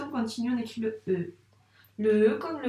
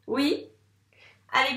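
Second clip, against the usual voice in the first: ordinary talk, nothing marked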